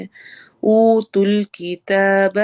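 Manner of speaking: اوتُوا الکتاب drawn out slowly with a long waw; slow Quranic recitation; slow madd. Shown as a demonstration. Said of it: "او واو مدہ".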